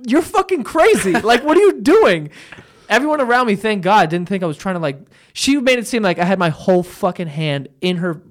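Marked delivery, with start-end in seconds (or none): 0.1-2.3 incredulous retort. Retold or imitated. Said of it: "I'm like".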